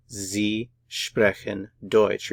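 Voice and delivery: "Sie sprechen Deutsch" very slowly, word by word; drawn-out teaching version of "Sie sprechen Deutsch"; slow model sentence. Shown as a demonstration. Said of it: "Put it all together".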